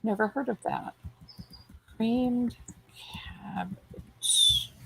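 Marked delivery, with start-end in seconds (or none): 2.0-4.7 soft, half-whispered words while typing; muttered to herself. Real, not acted. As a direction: murmur the search term under her breath. Typing the search term aloud.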